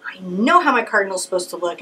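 normal talking voice

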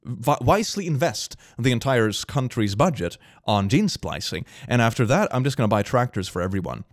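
ordinary talk, nothing marked

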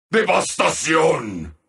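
low, solemn announcer pronouncement; grave announcer call. Acted. Announcer declaring devastation, gravely.

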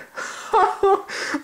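high, mocking cackle of dogs; howling laughter. Dogs jeering at Oscar, high howling laugh. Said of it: "they howled with laughter".

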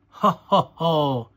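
loud, spoken-out ho-ho laugh; acted laugh. A narrator's reading. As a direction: read out a hearty storybook laugh. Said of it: "Daddy Pig laughs".

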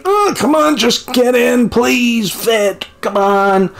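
high, exasperated begging at the screen; frustrated pleading. Retold or imitated. Frustrated at game piece fitting.

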